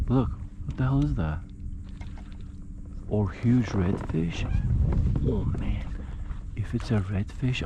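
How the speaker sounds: softly, eager and hushed so the fish won't spook; quiet excited spotting; hushed excitement. Real, not acted. Spotting fish, not scaring it.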